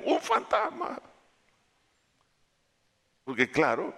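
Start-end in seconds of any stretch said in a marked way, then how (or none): 0.0-1.0 high laughing mock of ghost; amused high mock cry. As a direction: laugh at their panic as you say it. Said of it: "Pero ellos dijeron".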